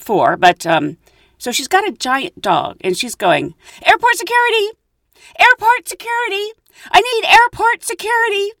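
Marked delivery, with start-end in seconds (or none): 3.9-8.5 high, loud shout for help; mock yell. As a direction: imitate a frantic woman calling out across the airport. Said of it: "you just yell that in the airport".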